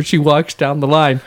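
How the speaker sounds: ordinary talk, nothing marked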